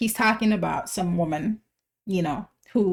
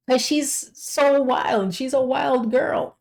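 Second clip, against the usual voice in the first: slow, higher, savouring each word; gleeful drawn-out delight; 5 semitones higher, 3 dB louder, slower than usual. Acted. Reacting to the song's wild woman, gleeful and drawn out. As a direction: relish it like juicy gossip.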